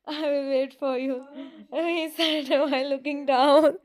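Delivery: can't get through the line for laughing. Real, not acted. Reader cracks up while voicing Jimin, laughing loudly. Reader breaks up mid-story.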